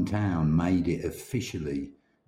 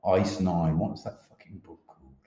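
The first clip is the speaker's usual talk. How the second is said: ordinary talk, nothing marked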